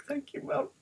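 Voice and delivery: very high, soft tearful squeak; mock breaking down. Stressed child thanking mum on the phone, mock tearful. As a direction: act overwhelmed, thin high voice near tears. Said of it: "I literally broke down on the phone".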